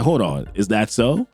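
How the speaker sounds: a hint of a stern patron scolding; old man. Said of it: "And he's like".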